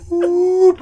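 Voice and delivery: high loud whoop, laughing through it; weird whoop noise. Shown as a demonstration. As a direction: try the doubled-voice whoop again. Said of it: "I'll try and do it".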